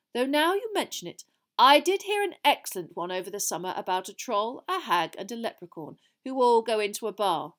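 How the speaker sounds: lively, projected start of an anecdote; Dumbledore, genially digressing. Acted. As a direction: wander off into a joke before the hall.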